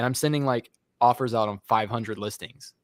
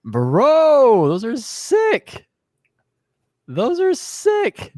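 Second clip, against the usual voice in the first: excited; 11 semitones higher, 6 dB louder, much slower than usual. Real, not acted. Excited about the shoes.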